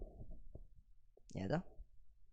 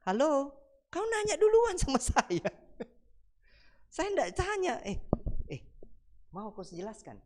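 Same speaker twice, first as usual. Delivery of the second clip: imitating an angry retort; 9 semitones higher than usual. An angry questioner scolds the believer, high mock anger. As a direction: play the offended one snapping back, hammed-up. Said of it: "Kalau dia marah-marah sama kita".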